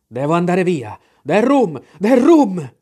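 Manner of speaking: building to a loud, high shout; urgent demand. A narrator's reading. Captain, desperate to leave, orders rum, mounting shouts.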